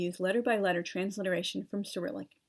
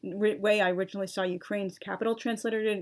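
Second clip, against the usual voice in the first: usual speaking voice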